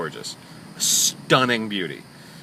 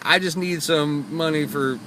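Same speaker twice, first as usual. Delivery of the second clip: slow, higher-pitched pleading caricature; caricature of homeless woman; 6 semitones higher, slower than usual. Retold or imitated. Driver imitating a homeless lady begging for money, slow caricature. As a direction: put on a drawn-out begging voice. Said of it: "I'm sorry for the horrible caricature".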